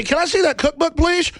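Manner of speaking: high-pitched mock request; mock politeness. Victim asking to see the cookbook, mock polite. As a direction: pitch it up like a wary, polite guest. Voices himself asking from the pot.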